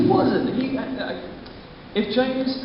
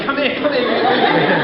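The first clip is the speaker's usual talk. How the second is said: calling out; 9 semitones higher, 6 dB louder than usual. Retold or imitated. Calling over an airbender.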